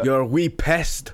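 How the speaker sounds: slow put-on Scottish; Scottish accent. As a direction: roll it out like a Scottish bloke. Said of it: "I can do a good Scottish".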